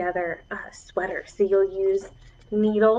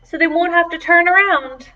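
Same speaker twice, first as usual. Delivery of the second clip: put-on higher bright little girl voice; cheerful girl; 6 semitones higher, 6 dB louder than usual. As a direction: offer it brightly like a kid with an idea. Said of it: "Annabelle said".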